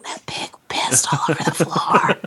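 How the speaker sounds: put-on whispered like a child sharing a naughty secret; gleeful whisper. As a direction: hush it, delighted and conspiratorial. Kids retelling gleefully, leaning forward.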